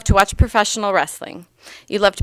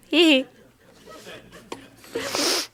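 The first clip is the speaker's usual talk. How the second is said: a high little hee hee; playful giggle; 8 semitones higher, 5 dB quieter than usual. Acted. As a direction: tease with a squeaky giggle. Joke at end of funny line.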